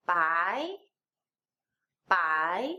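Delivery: "bai" starting low, sweeping upward; rising second tone. Shown as a demonstration. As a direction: lift each "bai" like a question. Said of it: "second tone".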